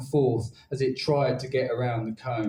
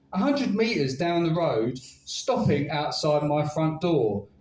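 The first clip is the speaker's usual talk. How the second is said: ordinary talk, nothing marked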